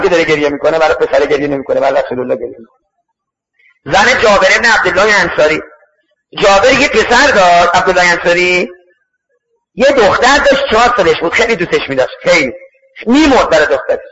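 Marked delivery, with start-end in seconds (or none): none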